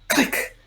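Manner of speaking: a soft, voiceless mouth click like a lock shutting. Retold or imitated. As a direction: breathe out a tiny click of a lock.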